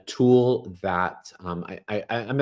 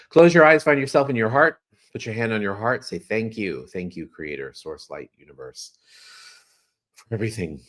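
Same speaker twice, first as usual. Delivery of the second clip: guided prayer; 4 dB louder than usual. Acted. Leads a gratitude prayer.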